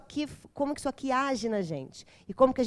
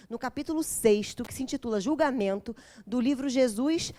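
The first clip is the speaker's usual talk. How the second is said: normal talking voice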